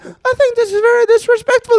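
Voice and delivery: the patient protests in his squeaked voice; offended high voice. Retold or imitated. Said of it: "the other guy's just like".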